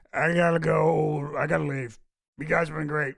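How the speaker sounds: put-on lightly slurred drunk goodbye. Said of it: "Alex Jones is hammered drunk".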